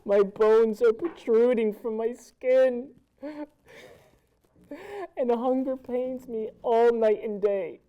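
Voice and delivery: loud despairing cry; anguished lament. Acted. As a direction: wail it to the sky. Starving castaway's loud lament.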